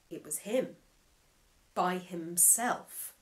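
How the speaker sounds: plain reading voice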